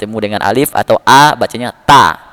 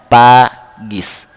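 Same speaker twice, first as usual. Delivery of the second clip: 1 slow spelled reading. Shown as a demonstration. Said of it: "seandainya K ini memiliki titik".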